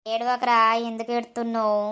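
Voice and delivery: consoling. Acted. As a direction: gently ask him to stop crying. Consoling crying friend. (ఓదార్చే మృదువైన స్వరం)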